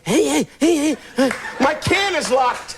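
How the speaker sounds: mock seal barking, slow at the end. Comic bit about Lasorda as a seal, mock seal bark. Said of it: "What are you, a seal?".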